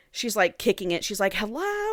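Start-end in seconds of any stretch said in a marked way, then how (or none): 1.3-1.9 calling out. Retold or imitated. Irene kicking at the box, calling out high. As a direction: lilt it up high like calling from confinement. Acted trapped woman calling out.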